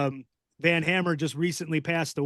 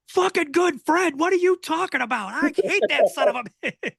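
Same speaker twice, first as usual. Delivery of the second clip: mock outrage, higher and louder, fast at the end; indignant rant that cracks into a laugh; outraged Bull; 12 semitones higher, 4 dB louder than usual. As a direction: ham up a furious old wrestler ranting. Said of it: "And he's like".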